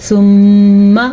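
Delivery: ثم with a long, loud nasal "mm"; ghunna on meem. Shown as a demonstration. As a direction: hum through the doubled meem of ثم. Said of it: "کیسے ہوتا ہے غنہ؟".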